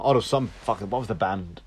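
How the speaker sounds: usual speaking voice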